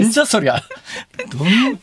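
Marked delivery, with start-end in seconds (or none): 0.0-0.6 amused outburst, pitch up; laughing retort. Real, not acted. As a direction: laugh through the what-the-heck. Reacts to joke intro.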